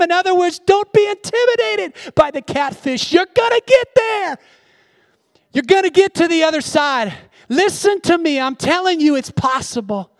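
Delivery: rising high and loud, urging the church on; preaching at full force. Real, not acted. Pastor urging the congregation not to lose heart, preaching at full force. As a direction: preach it out to them with fire.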